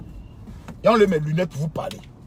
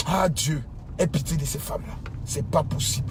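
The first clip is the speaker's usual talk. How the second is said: sighed prayer; 4 semitones lower, 6 dB quieter than usual. Real, not acted. Prière soupirée pour les femmes.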